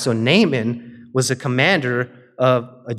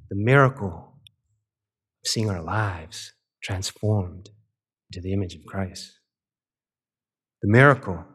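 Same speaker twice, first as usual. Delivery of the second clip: hushed preaching litany; 5 semitones lower, 4 dB quieter than usual. Acted. Preacher closing the sermon for the congregation, hushed reverent litany. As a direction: let each miracle land gently, almost prayerful.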